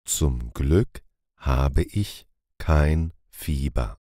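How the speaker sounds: ordinary delivery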